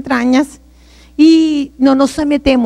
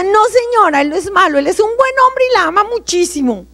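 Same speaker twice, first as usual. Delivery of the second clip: raised-pitch insistence, reenacted; mother's firm correction; 7 semitones higher than usual. Mamá defending papá to her daughter, insistent.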